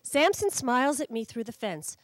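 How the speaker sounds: plain reading voice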